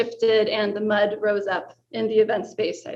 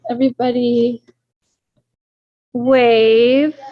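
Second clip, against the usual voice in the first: raised voice to the room; calling out; 8 dB louder, slower than usual. Real, not acted. Host rallying the room to wave at Zoom, calling out. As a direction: call it out loudly, drawn out.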